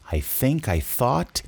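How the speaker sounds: slow "I think I thought", both TH sounds between the teeth; tongue-between-teeth TH. Shown as a demonstration. Teacher drills TH for pronunciation practice, slow careful dental TH. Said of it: "duas vezes essa língua entre os dentes".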